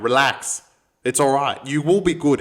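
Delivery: ordinary talk, nothing marked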